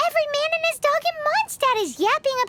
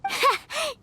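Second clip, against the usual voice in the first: amused giggle; 2 semitones higher, 3 dB quieter than usual. Acted. Paimon laughs at the name.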